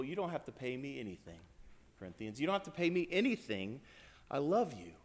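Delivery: a soft, affectionate release; tender affection. Retold or imitated. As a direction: reassure the Corinthians lovingly. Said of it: "but it's tender".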